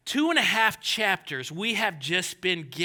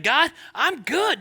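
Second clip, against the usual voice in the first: smug self-satisfaction; 9 semitones higher, 7 dB louder, slower than usual. Retold or imitated. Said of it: "you're like".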